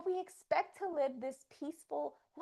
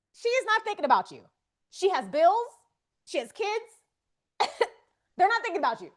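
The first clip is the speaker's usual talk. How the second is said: loud, higher emphatic reassurance; 7 semitones higher, 10 dB louder than usual. Real, not acted. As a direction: insist loudly, a bit fast, that nobody cares.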